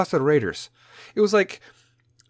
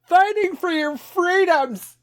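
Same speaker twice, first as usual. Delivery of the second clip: very high, slow, loud mock-heroic cry; mock patriot; 5 dB louder, slower than usual. Joking about serving in Afghanistan, mock-heroic squeal. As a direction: stretch it out in hammed-up patriotism.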